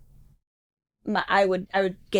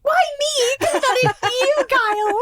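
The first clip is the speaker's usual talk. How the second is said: shocked squeal; 3 dB louder, slower than usual. Real, not acted. Mock outrage at the text.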